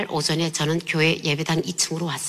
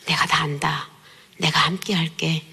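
low, gentle reassurance, slowing at the end; retold divine comfort, low and unhurried; comforting voice of God; 5 semitones lower than usual. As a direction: console tenderly in a lowered voice. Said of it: "음성이 들려요".

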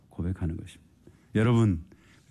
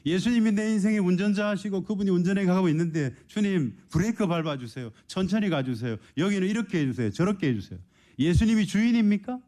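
high, ringing preacher's challenge; fervent preaching; 12 semitones higher than usual. Acted. Preacher challenging the congregation, ringing high cry. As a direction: call it out to the whole church.

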